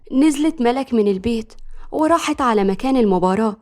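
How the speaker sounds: plain reading voice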